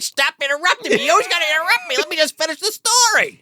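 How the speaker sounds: high mock scolding; high annoyed mom. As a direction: whine it like an impatient mom. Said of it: "She's like".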